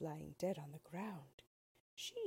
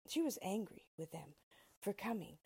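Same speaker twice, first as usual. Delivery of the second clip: plain reading voice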